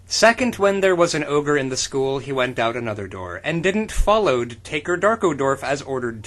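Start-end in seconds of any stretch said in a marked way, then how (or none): none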